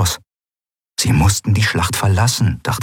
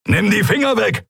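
scharfer Befehl; 13 semitones higher than usual. A narrator's reading. Keru schlägt ihre Hand weg.

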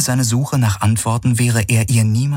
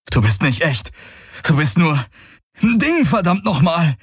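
rising from hurt to a high outburst; anguished denial swelling to a cry; building anger; 9 semitones higher than usual. Acted. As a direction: push her away harder with each word. Galen rejecting illusion.

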